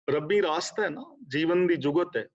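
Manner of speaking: usual speaking voice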